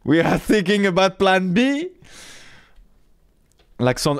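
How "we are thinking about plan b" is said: put-on higher-pitched German-Italian accented English; Gunther's accent. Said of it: "l'accent de Gunther est pas facile à faire".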